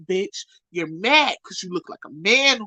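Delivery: ordinary talk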